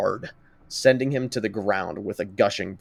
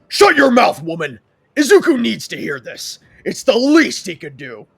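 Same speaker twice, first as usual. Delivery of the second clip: loud, higher, furious bark; full of venom, shouting her down; vitriolic; 12 semitones higher, 10 dB louder than usual. Acted. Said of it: "he says with vitriol".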